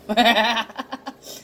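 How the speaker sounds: high, open burst of laughter; real gleeful cackle; triumphant laugh. Real, not acted. Gloating that the mockery failed.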